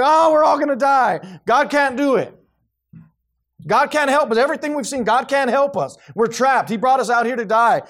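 loud, high-pitched wail of doom; hammed-up despair at full volume; mock panic. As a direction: cry out as a panicking, doubting crowd. Acts out panicking Israelites at Red Sea.

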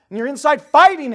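high, loud preacher cry; full-force preaching. Acted. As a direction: press hard on every phrase.

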